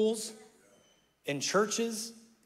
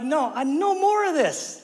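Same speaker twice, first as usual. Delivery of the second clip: much higher and louder, fed-up king; emphatic refusal; 9 semitones higher, 5 dB louder than usual. Retold or imitated. Revivalist king refusing, high emphatic outcry. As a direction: cry out that it ends now. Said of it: "he's like".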